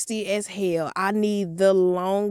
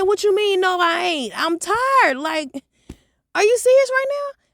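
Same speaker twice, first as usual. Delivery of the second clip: high, louder whiny protest; outraged teen; 11 semitones higher, 4 dB louder than usual. Retold or imitated. Daughter protesting to mom, high whiny outrage. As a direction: squeal it, indignant and tired. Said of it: "I said".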